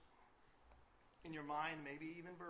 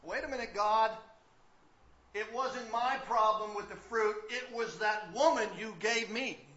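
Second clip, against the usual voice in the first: Adam's excuse; 7 semitones higher, 19 dB louder, slower than usual. Retold or imitated. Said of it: "sounds like Genesis chapter three".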